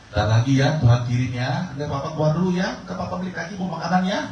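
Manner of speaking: put-on calm the child, then hurry out the door; father soothing child. Father promises the child he will bring food, coaxing and high.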